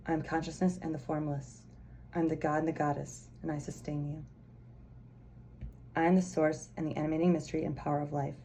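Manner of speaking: soft, solemn oracle reading; card message reading. Acted. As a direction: intone it gently like the card speaking.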